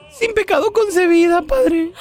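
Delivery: high, put-on female penitent voice; woman confessing. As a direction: pitch it way up as a pious woman. Woman at confession answers the priest.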